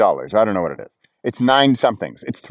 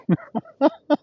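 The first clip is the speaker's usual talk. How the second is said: high burst of real laughter; 13 semitones higher than usual. Real, not acted. Vlogger cracking up at his own zero-income joke, real high laugh. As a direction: crack up at your own punchline. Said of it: "I'm still laughing".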